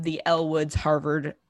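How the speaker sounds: usual speaking voice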